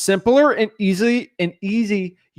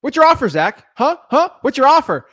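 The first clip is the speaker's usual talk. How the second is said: pushy seller demanding the offer, higher and louder; attacking seller; 8 semitones higher, 5 dB louder than usual. Retold or imitated. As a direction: fire the question at him impatiently. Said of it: "just attacking me on what my offer is".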